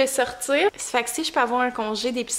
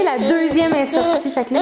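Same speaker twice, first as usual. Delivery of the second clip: usual speaking voice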